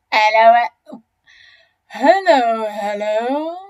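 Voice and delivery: loud hailing hippo voice; booming repeated hello; Humpy Rumpy the hippopotamus, greeting. Acted.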